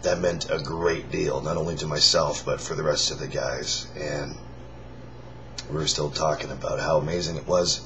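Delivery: hoarse, raspy near-whisper; forcing air through a worn-out voice; lost voice. Real, not acted. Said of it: "This is me with no voice".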